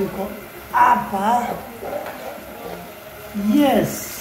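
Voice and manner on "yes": ordinary delivery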